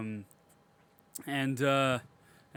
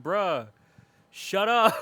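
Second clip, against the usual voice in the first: high, loud shut-up; exasperated shout; 5 semitones higher, 5 dB louder, much slower than usual. Retold or imitated. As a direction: yell at the screen. His own retort.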